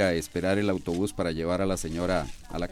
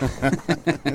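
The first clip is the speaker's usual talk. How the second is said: loud laugh; 7 semitones higher, 4 dB louder than usual. Acted. Laughing at the spinster remark.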